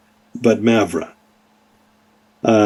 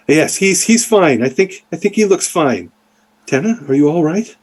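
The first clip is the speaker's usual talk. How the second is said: higher-pitched motherly voice; reassure, then check on the child, pitched up; worried mom, raised pitch; concerned mother; 5 semitones higher than usual. Acted.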